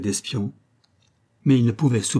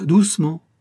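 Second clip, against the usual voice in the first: higher, louder, smiling warning; smiling menace; 7 semitones higher, 5 dB louder than usual. A narrator's reading. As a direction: smile while threatening. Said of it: "fit-il avec un sourire".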